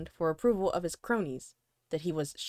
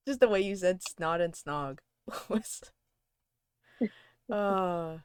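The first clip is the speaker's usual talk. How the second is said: plain reading voice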